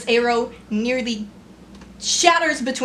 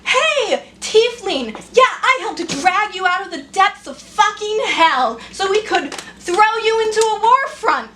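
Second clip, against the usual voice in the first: bitter mock greeting; 7 semitones higher than usual. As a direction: spit the greetings out. Sarcastic greeting series.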